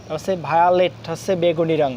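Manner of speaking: usual speaking voice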